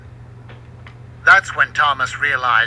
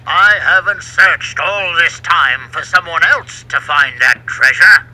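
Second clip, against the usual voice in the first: loud, angry growl; 8 dB louder than usual. A narrator's reading. Sailor furious someone else found the treasure, loud growl. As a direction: growl it through your teeth in rage. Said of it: "he growled".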